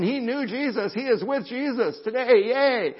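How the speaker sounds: high, loud imagined cheer; heads rejoicing. Retold or imitated. The heads of a grieving family reassuring themselves, rejoicing. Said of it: "their heads are going".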